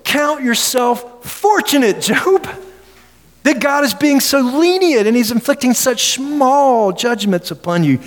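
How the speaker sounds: mock Job with fake pity; sarcastic Zophar. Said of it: "Listen to what he says".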